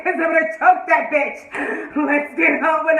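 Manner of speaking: giggly mock threat turning to move on; laughing rant. Real, not acted. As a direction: laugh while you threaten.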